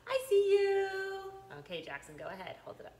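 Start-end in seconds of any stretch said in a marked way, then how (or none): 0.0-0.9 surprised peekaboo. Acted. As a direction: gasp then a high I see you. Peekaboo reveal with gasp.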